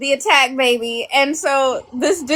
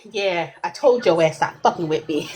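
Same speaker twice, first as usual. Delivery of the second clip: lower, smug cat voice, softer; 8 semitones lower, 4 dB quieter than usual. Pretend. The cat gloating at its owner, low smug put-on voice.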